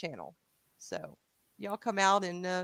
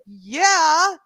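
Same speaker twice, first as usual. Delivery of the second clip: loud, higher rising "yeah"; emphatic agreement; 7 semitones higher, 7 dB louder than usual. Retold or imitated. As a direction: agree with a big raised-pitch lift. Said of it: "I'm like".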